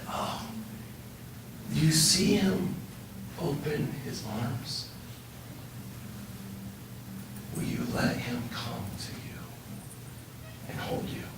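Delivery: barely voiced, tender guidance; tender whisper. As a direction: invite her gently into his arms. Whispers invitation to be held.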